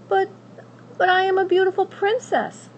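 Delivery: high, indignant protest; spoiled princess. Acted. Princess protesting to the witch, high and indignant. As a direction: insist on your rank.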